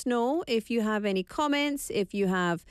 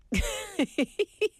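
laugh; 11 semitones higher than usual. Real, not acted. Laughs at own French.

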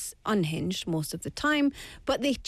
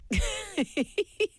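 high laugh, with pitch well above the speaker's usual; 10 semitones higher than usual. Real, not acted. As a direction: laugh at your own school French. Laughs at own school French.

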